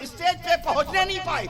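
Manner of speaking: high, forceful sermon delivery; fiery preaching. Real, not acted. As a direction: drive each phrase home to the crowd. Preacher telling story at full force. (پرجوش خطیبانہ انداز، اونچی آواز)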